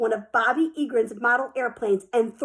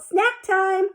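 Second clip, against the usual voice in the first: called out; 8 semitones higher, 4 dB louder than usual. A narrator's reading.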